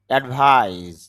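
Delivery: অ্যাডভাইস ending in a buzzing z; final z sound. Shown as a demonstration. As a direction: voice the last consonant of অ্যাডভাইস as z. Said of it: "জ sound হয়". (অ্যাডভাইস-এর শেষে জ (z) sound)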